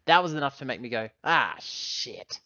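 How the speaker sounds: ordinary talk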